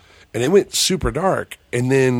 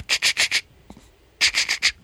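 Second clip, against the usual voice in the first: voiceless rapid chattering clicks; unvoiced stuttering "ch" sound effect; imitated strange noise. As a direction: imitate a mystery rattle with "ch" bursts. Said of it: "it's like a".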